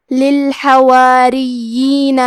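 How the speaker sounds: للحواریین chanted slowly, with thin ر, doubled ی and a long ee; clear doubled ya. Shown as a demonstration. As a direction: draw out للحواریین, leaning on the doubled ya. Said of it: "مد یا کو بھی ظاہر کریں گے". (للحواریین میں یا کی تشدید اور مد، ٹھہر کر)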